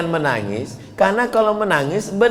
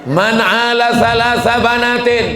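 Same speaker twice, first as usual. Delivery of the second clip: raised, chanted Arabic hadith line; hadith recitation; 5 dB louder than usual. Acted. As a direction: recite it like a preacher declaiming.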